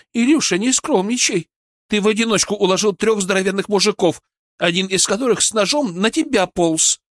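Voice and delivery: high, fast, worked-up praise; excited young woman. A narrator's reading. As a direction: gush over his bravery. Veronika voiced, excited.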